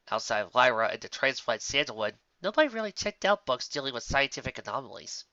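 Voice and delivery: ordinary delivery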